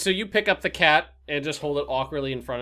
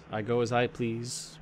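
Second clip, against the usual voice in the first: ordinary talk, nothing marked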